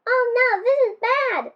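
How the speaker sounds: high, alarmed cry of oh no; a penguin yelping in alarm; alarmed shout. A narrator's reading. Pete cries out as Pepin floats away, alarmed. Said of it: "Pete shouted".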